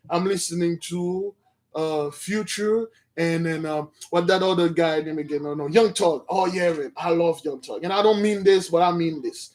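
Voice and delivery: musing about his rap taste half to himself; a hint of a gruff old man; lightly voiced Abner. Voiced Abner boasting about rappers.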